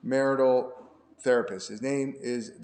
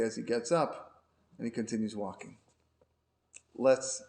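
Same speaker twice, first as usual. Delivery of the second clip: ordinary talk, nothing marked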